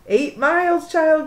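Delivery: put-on loud croak of an old woman; croaking grandma. A narrator's reading. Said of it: "Grandma croaked".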